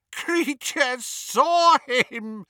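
high, slow gasped elf voice through tears; weeping house-elf gasping. A narrator's reading. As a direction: choke the words out between sobs. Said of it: "gasped the elf".

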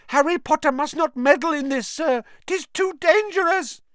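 high, loud, urgent elf voice; pleading house elf. Acted. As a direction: urge him home like a scared elf. Dobby warning Harry.

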